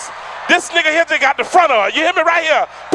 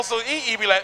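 normal talking voice

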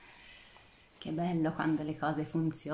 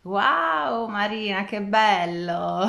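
loud thrilled wow; delighted wow; 3 semitones higher, 10 dB louder, slower than usual. Real, not acted. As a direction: burst out with delight at the view. Delighted at Marina's green setting.

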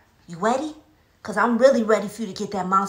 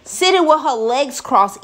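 louder, slow spooky narration; slow dramatic reveal of the monster; dramatic storytelling; 6 dB louder, slower than usual. A narrator's reading. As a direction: lean in and draw out the scary reveal. Climax of the scary story.

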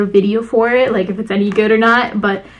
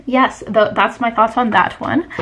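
ordinary delivery

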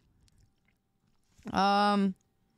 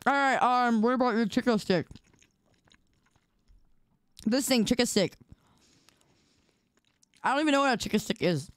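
ordinary talk, nothing marked

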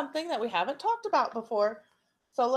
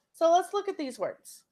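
usual speaking voice

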